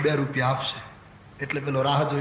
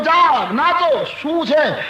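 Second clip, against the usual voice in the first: high, loud excited cries; feigned jubilation; 10 semitones higher, 5 dB louder, much slower than usual. Retold or imitated. Merchant waking his wife to dance, acting jubilant. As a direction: whoop it up as if overjoyed.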